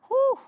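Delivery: high, loud woo; excited whoop. Real, not acted. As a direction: whoop with the effort. Coach cheering during workout.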